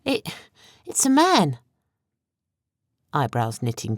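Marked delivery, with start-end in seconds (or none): 0.0-1.6 horrified. A narrator's reading. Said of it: "let out a gasp of horror".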